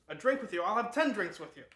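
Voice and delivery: loud and high, hearty and jolly; a big-hearted doctor who loves a drink; booming cheerful Valentini; boisterous doctor. Retold or imitated.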